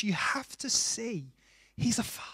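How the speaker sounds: soft whisper; hushed emphasis to the congregation. Real, not acted. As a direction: breathe it like a secret. Preacher drops to a whisper.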